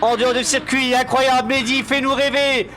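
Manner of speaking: plus aigu, exalté, encourageant; commentateur enthousiaste. Real, not acted. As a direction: pousser le pilote avec ferveur. Commente la fin de course. (commentaire survolté en direct)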